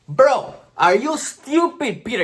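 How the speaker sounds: high, loud, stretched-out disbelief; exasperated outburst. Real, not acted. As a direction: drag out your disbelief at his stupidity. Exasperated at classmate's answer.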